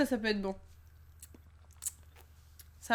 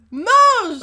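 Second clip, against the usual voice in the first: shouted order; 8 semitones higher, 14 dB louder than usual. Real, not acted.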